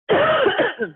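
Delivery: loud rasping throat clear. Real, not acted. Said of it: "I'm sorry, I'm choking".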